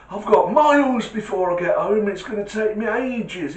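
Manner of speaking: put-on old man. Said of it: "He goes".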